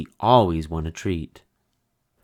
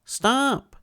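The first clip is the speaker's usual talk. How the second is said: calling stop; 3 dB louder than usual. Acted. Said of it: "he shouted".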